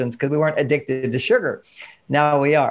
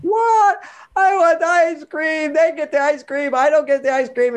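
put-on much higher, slow whiny child's wail; mock crying child; 10 semitones higher than usual. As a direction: wail it like a seven-year-old in tears. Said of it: "now the tears are coming out".

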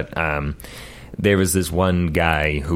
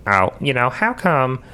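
Cleveland; 6 semitones higher than usual. Acted. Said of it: "Let's do Cleveland from Family Guy".

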